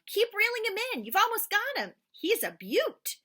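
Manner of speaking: high, loud urging; dad urging son to keep reeling; excited cheering. Acted. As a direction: push him on, thrilled.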